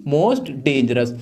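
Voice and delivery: "Most dangerous" drawn out very slowly; slow answer. Shown as a demonstration. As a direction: stretch each word for the class. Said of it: "क्या हो जाएगी?".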